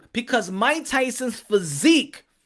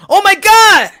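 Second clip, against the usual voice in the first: high, loud screaming fan; hammed-up overjoyed shout, half-sung in the middle; ecstatic fan; 11 dB louder, slower than usual. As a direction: lose it like a fan seeing his hero. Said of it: "the guy goes".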